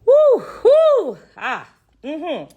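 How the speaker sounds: loud high cries of breath, holding back tears; emotional exhale. Real, not acted. Sharing her sepsis testimony live, overcome and fighting tears. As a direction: blow out the emotion to keep from crying. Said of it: "I don't wanna get emotional".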